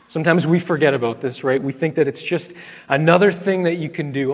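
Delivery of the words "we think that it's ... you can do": ordinary delivery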